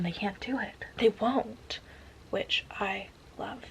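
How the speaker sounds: hushed whisper, slowing at the end; slow delighted whisper; conspiratorial whisper. Real, not acted. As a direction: whisper it like sharing a guilty secret. Savoring the tension.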